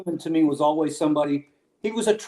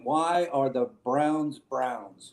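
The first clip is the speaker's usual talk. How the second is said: slow reading of search; 3 semitones lower, 8 dB quieter, much slower than usual. Real, not acted. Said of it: "I'm Googling".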